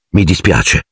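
ordinary delivery, nothing marked

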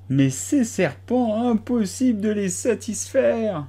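high, slow plaintive bird voice; complaining pigeon. A narrator's reading. Pigeon ignoring Alice, high plaintive litany. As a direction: list the failures in a plaintive wail.

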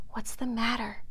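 hushed, voiceless whisper of concern; solicitous whisper. A narrator's reading. Bambi comforting terrified Faline, solicitous whisper. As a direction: whisper it gently to comfort someone. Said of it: "he whispered solic-, solicitously".

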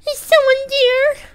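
very high, tiny squeak calling out; high-pitched squeaky call; squeaky cartoon voice. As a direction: squeak like a little cartoon creature.

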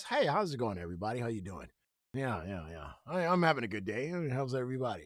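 soft, low, overly polite nice-guy greeting; lightly voiced polite classmate. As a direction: play the proper kid in class, softly. Imitating a proper, polite classmate.

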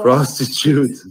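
playful different take. Shown as a demonstration. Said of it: "I will say it different".

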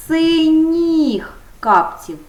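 drawn out, emphasising the ending; slow model answer. Shown as a demonstration. Teacher stressing the ending for the class, slow and loud. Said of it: "на м'який приголосний".

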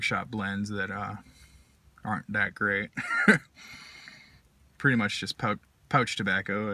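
talking around pipe stem; pipe in mouth. Real, not acted. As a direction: lips clenched on pipe. Seen 4: Pipe stem held between lips throughout the labelled speech.

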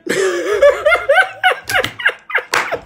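shrieking high laugh at her own joke; real shrieking laugh; high burst of laughter. Real, not acted.

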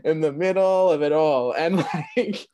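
high, loud, laughing emphasis; amused disbelief. Real, not acted. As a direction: exclaim it through laughter, pitch up.

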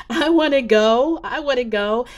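excited. Retold or imitated. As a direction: jump up begging to go along. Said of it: "I jumped up and I said".

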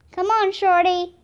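high, loud urgent call. A narrator's reading. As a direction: holler to your partner from a distance. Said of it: "came Slim's voice".

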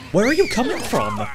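high, loud startled yell; alarmed shout. Real, not acted. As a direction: shout in disbelief at an unseen attacker. Surprised by attacker in game.

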